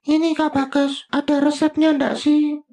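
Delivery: high character voice cutting in to ask Kak Bagas; Bobi voice. Acted. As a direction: pitch it way up like a little puppet friend. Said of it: "Bobi tanya deh".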